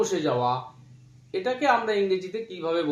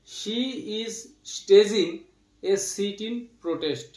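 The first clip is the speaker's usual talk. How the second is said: slow dictation; much slower than usual. Shown as a demonstration.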